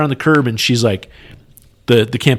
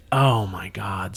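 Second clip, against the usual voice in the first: defeated groan; 4 semitones lower, 10 dB quieter, much slower than usual. Retold or imitated. Told the packet is rejected.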